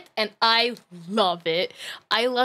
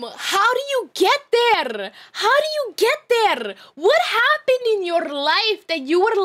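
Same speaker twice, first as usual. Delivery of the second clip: bewildered, pitched-up questioning; incredulous; 9 semitones higher than usual. Real, not acted. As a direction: demand an explanation in amazement. Incredulous at the criminal's story.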